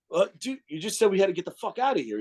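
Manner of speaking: high, fast, incredulous mock retort; exasperated. Speaker replying to his friend, high incredulous rush. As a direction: rush it out, baffled and flustered. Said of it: "I was like".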